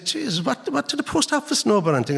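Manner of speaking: high, fast incredulous retort; exasperated. Retold or imitated. To the librarian, mocking the post office, high exasperation.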